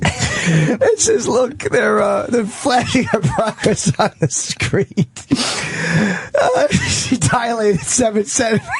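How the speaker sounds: high voice breaking into laughs; slow, squeaky with suppressed laughing; helpless laughter. Real, not acted. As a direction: struggle to read through real laughter. Laughing too hard reading comic.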